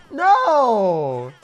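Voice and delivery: long, loud, high wail of no; anguished drawn-out shout; dismayed cry. Real, not acted. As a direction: cry out as it all goes wrong. Watches losing moment in replay.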